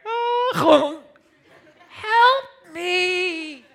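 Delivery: high, loud, hammed-up strangled cry; crushed, strained plea. Lifter pinned under a crushing bar, strained plea. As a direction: squeeze out a comic cry for help.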